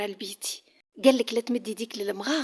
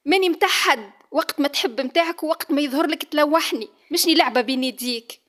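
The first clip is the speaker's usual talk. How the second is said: higher, louder angry protest; angry outburst; 4 semitones higher, 5 dB louder than usual. Acted. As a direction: throw it back at him in fury. Heroine fights off her husband. (صياح بغضب)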